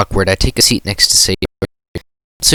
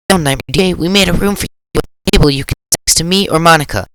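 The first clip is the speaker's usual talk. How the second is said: put-on bright girl voice; 7 semitones higher than usual. Voicing cheerful Sayori.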